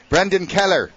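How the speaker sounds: put-on retired Irish pastor phoning the newcomer, Irish accent. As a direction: announce the name like a jovial Irish priest. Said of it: "It's an Irish accent".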